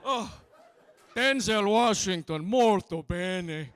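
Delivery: put-on slow, loud Italian-accented gushing; Italian tour guide. Italian guide gushing about a film star, loud Italian accent. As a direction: savour the name like a starstruck Italian guide. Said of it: "the tour guide was just like".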